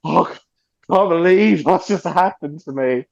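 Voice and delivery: slow, dreamy mock swoon; starstruck, drawn-out; swooning disbelief. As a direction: sigh it out like you're overwhelmed. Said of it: "I'm like".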